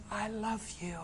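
very soft, intimate voice; hushed declaration. Acted. Jesus telling a believer of his love, hushed. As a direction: let it drop almost to nothing, tenderly.

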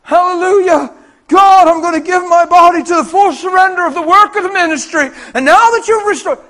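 loud, high, over-pious proclamation; mock revival fervour at full volume; hammed-up hallelujah. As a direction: shout it like a showy pledge to God. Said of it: "the man didn't like".

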